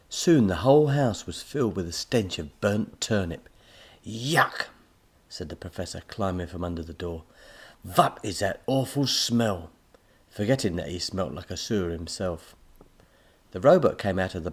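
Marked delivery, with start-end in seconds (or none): 4.0-4.7 disgusted. Acted. Professor smells burnt turnip. 7.8-9.7 disgusted. Acted. Professor smells burnt turnip.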